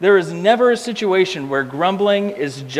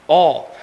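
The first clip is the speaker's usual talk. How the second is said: All, said alone with weight; All set apart and stressed; 3 semitones lower, 3 dB louder than usual. Shown as a demonstration. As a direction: lean on the single word All. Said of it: "the word that Paul emphasizes here is the word all".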